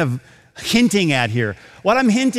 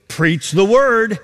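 slow and louder on "Preach the word"; emphatic charge; 4 semitones higher, 3 dB louder, slower than usual. Acted. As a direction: drive home the command.

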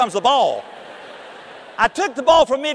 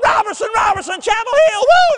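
imitating a crowd, much higher and louder, climbing to a whoop; crowd hollering; 12 semitones higher, 6 dB louder than usual. Fans storming the court after the win, hollering. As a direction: holler it like fans rushing the floor. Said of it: "they were coming across that floor hollering".

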